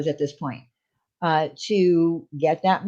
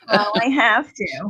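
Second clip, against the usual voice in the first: whiny voice. Acted. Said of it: "It's very funny".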